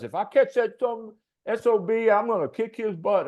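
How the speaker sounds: imitating a drunk angry man threatening at the bar; angry threat. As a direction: threaten to kick his butt, full of bluster. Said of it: "heard him talking to the bartender".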